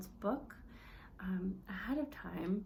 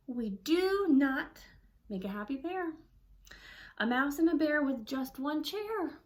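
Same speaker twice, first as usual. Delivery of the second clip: frustrated reading; 3 semitones higher, 4 dB louder than usual. Shown as a demonstration. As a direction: read it like a grumpy mouse. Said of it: "show that in our voice and in our attitude".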